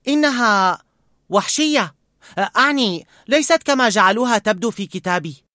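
put-on a worried boy begging; young boy voice. A narrator's reading. Boy pleads with old man to help bird.

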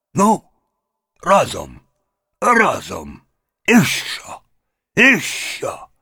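loud, high effort cries; straining heave. Acted. Grandfather hauling the heavy cauldron, straining shouts.

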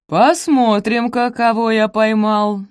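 put-on slurred drunken gloating, slow; frat bro. A narrator's reading. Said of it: "заплетающимся языком проговорил он".

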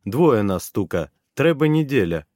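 slow halting made-up Bulgarian; broken Russian-Bulgarian. Acted. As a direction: piece the words together slowly. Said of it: "изрёк я, видимо, на каком-то своём языке".

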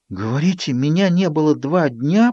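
slow, breathed-out disbelief; stunned gasp. A narrator's reading. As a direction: breathe it out in shock. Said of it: "потрясённо выдохнула я".

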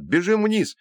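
high-pitched urgent cry; excited high call to run. A narrator's reading. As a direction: shout for her to flee, pitch up. Brother urges escape in the dark.

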